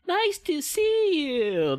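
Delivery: put-on baby talk. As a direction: coo at a cute little baby, high and drawn out. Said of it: "the kid talk thingy".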